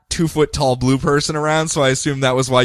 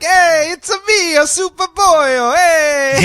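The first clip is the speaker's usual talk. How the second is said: loud, high, hammy Mario voice; a Mario impression, "it's a me"; Italian Mario accent; 5 dB louder, slower than usual. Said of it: "So is he like".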